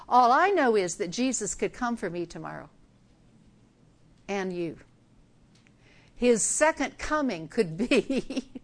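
ordinary delivery

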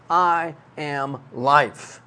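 each word slowed and weighted; slow, solemn emphasis on "I am life."; slow emphatic statement. Shown as a demonstration. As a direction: lay out every word deliberately. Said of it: "you're trying to emphasize something".

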